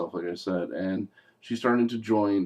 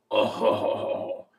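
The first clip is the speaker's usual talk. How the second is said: slow, put-on dark lord groan; groan, then slowly scold the underling; hammed-up exasperated ruler of hell; Satan; much slower than usual. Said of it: "And he goes".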